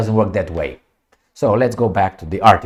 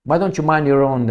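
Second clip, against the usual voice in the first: usual speaking voice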